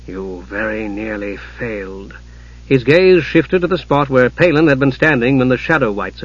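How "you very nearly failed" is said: low, slow, very soft reproach; quiet, menacing sorcerer uncle, low and unhurried; cold mage. A narrator's reading. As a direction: chide softly, stretching each word. Raistlin voiced, cold reproach to nephew.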